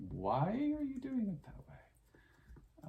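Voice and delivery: high, slow, drawn-out puzzled question; mock-baffled elder asking slowly; puzzled grandpa question. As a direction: stretch it out like a baffled old grandpa. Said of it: "with the nephews going".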